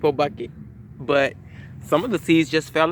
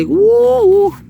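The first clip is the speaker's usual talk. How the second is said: high, loud drawn-out ooh; imitating grandma's delighted ooh; 10 dB louder than usual. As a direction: coo it like Grandma did. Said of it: "as Grandma used to say".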